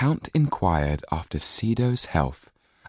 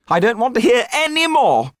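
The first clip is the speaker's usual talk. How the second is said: very high, loud refusal; petulant outburst; 7 dB louder than usual. A narrator's reading. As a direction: shut the lawyer out like a spoiled child. Marquis covering ears.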